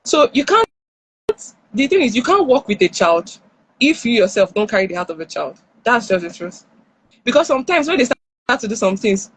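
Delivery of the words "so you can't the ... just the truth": usual speaking voice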